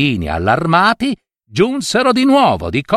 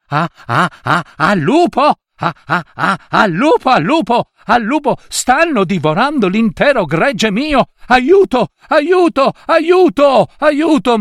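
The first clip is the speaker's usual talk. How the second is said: desperate cries; 9 semitones higher than usual. Acted. Shepherd boy begging the village for help, desperate. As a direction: scream for help as the wolves attack. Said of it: "cominciò a gridare disperatamente".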